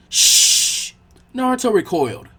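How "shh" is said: loud hissing shush; sharp shush. Acted. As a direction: hush him hard. Library patrons hushing Naruto.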